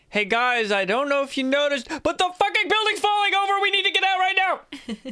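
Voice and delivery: escalating frantic yell; urgent warning shout. Acted. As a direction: holler an alarm down the stairs, rising in pitch. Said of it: "and be like".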